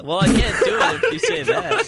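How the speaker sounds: giggling through the words; can't stop grinning while talking; laughing. Real, not acted.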